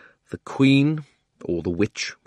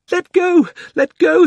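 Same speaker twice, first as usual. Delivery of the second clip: put-on very high, loud girlish scream; 9 dB louder, slower than usual. Polly screaming as her hair is pulled. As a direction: shriek it in pain. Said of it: "screamed Polly".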